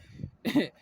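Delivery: short high laugh; burst of laughter. Real, not acted. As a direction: laugh out at your own joke. Laughs at own joke.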